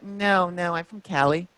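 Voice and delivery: breezy dismissive fan; imitating the fan. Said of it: "And she was like".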